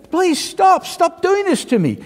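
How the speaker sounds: high, pleading cry; begging. Acted. Cup begging the potter in a high plea. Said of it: "and I'm begging the cu".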